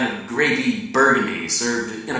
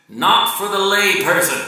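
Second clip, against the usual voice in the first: higher and louder, a hammed-up boast; quoting the man; 9 semitones higher, 6 dB louder than usual. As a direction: pop the line out like a proud insider. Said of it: "was what this man said of their game".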